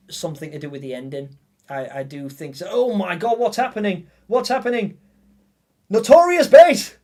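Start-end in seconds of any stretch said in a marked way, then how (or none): 2.7-5.0 startled, rising higher on the repeat; real surprise at an unexpected gift; surprised. Real, not acted. Sudden donation alert surprises streamer. 5.9-7.0 much higher, loud, slow thrilled call-out; real thrilled yell of thanks; excited shout. Real, not acted. Streamer shouts donor's name, thrilled.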